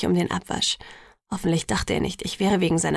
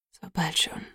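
voiceless whisper of dismay; soundless; 4 semitones lower, 6 dB quieter than usual. A narrator's reading. Jacob, stunned by the news, soundless whisper. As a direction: breathe it out almost without sound. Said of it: "sagte er lautlos".

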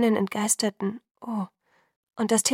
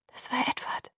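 soft, higher stifled whisper; choked whisper; 4 semitones higher, 5 dB quieter than usual. A narrator's reading. Alice revealing who really called, choked whisper. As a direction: barely get the words out, whispering. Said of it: "nur ein ersticktes Flüstern".

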